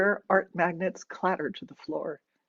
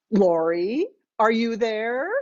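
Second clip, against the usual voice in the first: louder call down the phone, rising; mother checking in; 4 semitones higher, 5 dB louder than usual. A narrator's reading. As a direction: call out questioningly to a silent line. Mother calling into silent phone.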